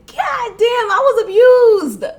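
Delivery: high, loud "God damn, I was abused"; realizing it all at once; stunned realization. Retold or imitated. Telling viewers about her ex, stunned realization.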